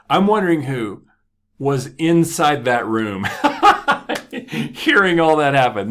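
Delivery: loud, high laughter through the words; laughing. Real, not acted. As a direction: crack up at the thought. Laughing at own punchline.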